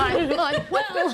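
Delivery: slow laughing drawl. Pretend. Joining the joke, slow laughing. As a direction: drag it out through laughter.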